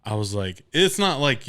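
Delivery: usual speaking voice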